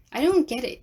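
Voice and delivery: ordinary delivery, nothing marked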